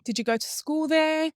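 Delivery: normal talking voice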